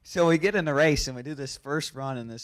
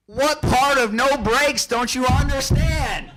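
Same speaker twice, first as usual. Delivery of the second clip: loud, much higher, drawn-out mock yell; angry dad shouting; 12 semitones higher, 7 dB louder, slower than usual. Dad on the radio to the pit crew, angry retold shout. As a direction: imitate a crew chief blowing up, slowly and loudly. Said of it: "he just goes off on these crew guys".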